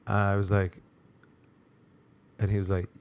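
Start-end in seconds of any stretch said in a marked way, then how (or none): none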